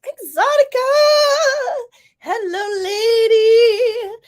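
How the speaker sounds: high, loud calling-out hello; excited greeting. Real, not acted. Host greets a friend joining the stream, excited high call. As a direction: sing out a big delighted welcome.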